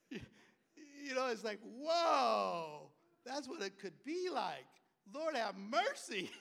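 slow, high, laughing whoa; laughing disbelief at the memory; stunned laughing. Real, not acted. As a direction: stretch the whoa out, amazed.

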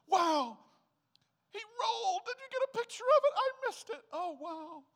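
put-on high cooing baby-talk voice, gushing; cooing parent. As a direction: gush over the baby. Excited parent over baby.

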